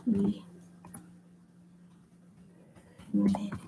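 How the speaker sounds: very soft, half-whispered self-talk; barely voiced words with long gaps; muttering while writing. Real, not acted. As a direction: murmur it to yourself as you write.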